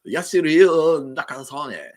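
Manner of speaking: slow, high, hammed-up Japanese accent; mock Japanese accent. Shown as a demonstration. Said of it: "So which one's more racist?".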